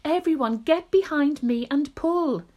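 rally everyone to heave; loud, high-pitched order to the crew; shouted command. Acted. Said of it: "shouted the old green grasshopper".

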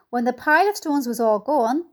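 ordinary delivery

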